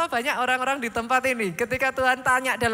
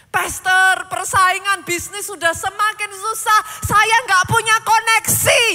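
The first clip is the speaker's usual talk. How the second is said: building from a plea to a loud higher shout; full-force preaching; 4 semitones higher, 14 dB louder than usual. Acted. Preacher shouting God's question at the congregation. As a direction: climb to a roaring climax.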